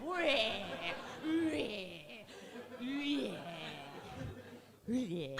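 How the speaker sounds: loud nonsense Cockney cries; stage Cockney. Shown as a demonstration. Stage Cockney character milking the laugh, loud hammed-up cries. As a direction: honk out wordless stage Cockney squawks. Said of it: "more like stage Cockney".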